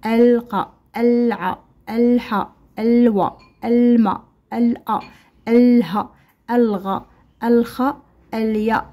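each item from الق to الفاء with a clear L; list-like drill, L pronounced in الق and the rest; sounded lam. Shown as a demonstration. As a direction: drill الق, الع, الح one by one, sounding the L. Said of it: "إلا جات قبل منهم ال فهي تنطق".

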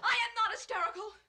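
high-pitched, indignant protest; hysterical denial. Acted. Wife denies being hysterical, in hysterical indignation. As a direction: insist you're in control while losing it. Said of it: "You're hysterical".

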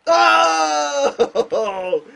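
loud high cry of victory; excited goal yell; triumphant shout. Real, not acted. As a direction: shout as the fake works. Scoring on the deke.

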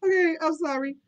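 helpless giggles breaking up the words; real laughing apology. Real, not acted. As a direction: apologize while trying to stop laughing. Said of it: "I know I shouldn't have laughed".

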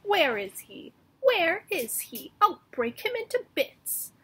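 high, loud blustering threat; fierce mouse. Acted. Tiny mouse boasting he will beat the elephant, loud and high. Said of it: "said the mouse".

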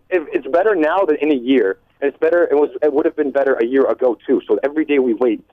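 usual speaking voice

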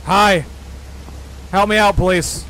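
high, loud cries for the police; calling for help. Pretend. Player yells to the police for help, loud cries. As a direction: yell out to the police for rescue.

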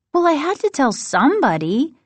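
defensive bite, lightly voiced; snapped edgily. A narrator's reading. Margaret to her brother, a mild edgy snap. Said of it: "she snapped edgily".

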